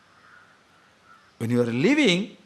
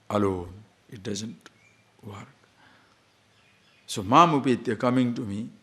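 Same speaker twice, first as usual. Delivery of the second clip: ordinary delivery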